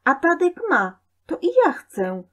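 high, loud tantrum demand; shrieky insistence of a little boy; child's shouting. A narrator's reading. Boy yells at mother for a bicycle, high and loud. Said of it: "krzyczy Złośnicki coraz głośniej".

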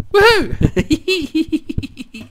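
high, laughing woohoo; joyful whoop. Real, not acted. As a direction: celebrate at the top of the voice. Celebrating the viewer's shout-out.

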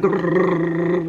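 long rolled trembling noise; imitated shaking. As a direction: trill it like a muscle shuddering. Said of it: "butt cheek was like".

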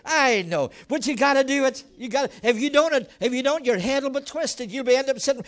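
normal talking voice

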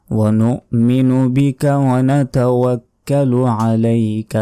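slow, drawn-out Arabic recitation; Quranic recitation. Shown as a demonstration. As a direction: recite reverently, holding every long vowel. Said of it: "আমি যেভাবে আরবিতে বলছি এভাবেই মুখস্থ রাখবেন".

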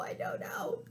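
very soft, breath-only whisper; hushed, unvoiced wondering; puzzled whisper. Real, not acted. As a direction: whisper it as if stumped. Whispered puzzlement at mystery creature.

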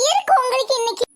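ordinary talk

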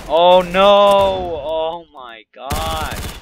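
loud dismayed outburst; hyped gamer bro. Real, not acted. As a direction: yell it as the match turns. Dying in the game.

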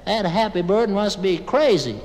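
high, loud dopey protest; doubtful Dingle. Acted. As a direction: protest loudly like a simple country pal. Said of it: "And Dingle, he says".

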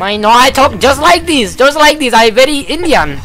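mock Indian accent. Teasing his duo partner in a put-on Indian accent. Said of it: "I knew you didn't talk like that".

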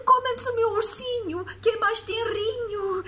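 put-on high, loud pleading child; scared little boy. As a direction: beg like a frightened child. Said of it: "disse-lhe o Mateus".

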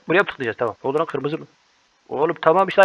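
ordinary talk, nothing marked

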